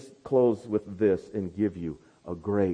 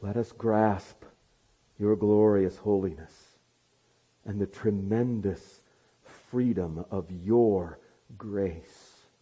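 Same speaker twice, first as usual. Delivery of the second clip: pastoral prayer; 2 semitones lower, slower than usual. Acted. Closing prayer.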